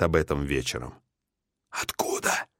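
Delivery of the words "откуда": a soft voiceless whisper. Acted. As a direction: whisper it suddenly. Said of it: "вдруг шёпотом спросил биолог".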